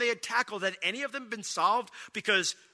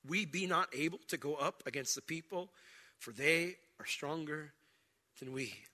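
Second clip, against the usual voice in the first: low, subdued, trailing softer; reluctant reading; 4 semitones lower, 6 dB quieter than usual. Real, not acted. Preacher reading the spies' refusal he hates, reluctant and subdued. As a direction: read it as if hard to say, with pauses. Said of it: "I almost can't even say it".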